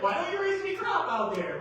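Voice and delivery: loud, high-pitched berating; mock scolding. Farmer berating his brother over dead ground, loud mock scolding.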